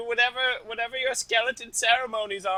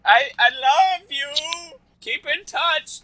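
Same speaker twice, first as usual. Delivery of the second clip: higher, louder mock-tender goodbye; hammy farewell; 7 semitones higher, 6 dB louder than usual. [name] saying goodbye to the skeletons, hammed-up high farewell. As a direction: overplay the parting.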